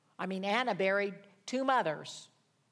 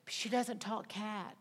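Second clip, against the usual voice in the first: ordinary delivery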